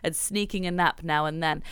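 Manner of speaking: reading a review aloud. Acted. Host reading a fan review to co-host, gentle read. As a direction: read the listener's message aloud.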